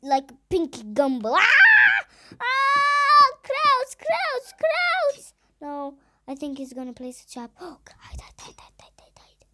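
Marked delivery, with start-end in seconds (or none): 1.3-5.3 high panicked screams; terrified horror victim. Real, not acted. Chased by the piggy. 5.6-9.5 terrified hushed whisper; frightened whisper. Real, not acted. Hiding from the piggy.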